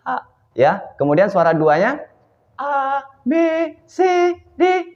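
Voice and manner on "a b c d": "A, B, C, D" much higher in head voice. Shown as a demonstration. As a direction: lift the letters into head voice. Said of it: "itu adalah suara, suara yang kedua".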